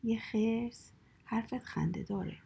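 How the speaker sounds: usual speaking voice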